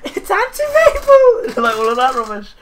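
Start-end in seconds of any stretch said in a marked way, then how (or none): 0.0-0.6 put-on high sing-song old auntie voice; elderly singsong. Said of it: "and he's like". 0.7-1.5 laughing. Real, not acted. Laughing after Auntie Mabel impression. 1.5-1.6 put-on high sing-song old auntie voice; elderly singsong. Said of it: "and he's like".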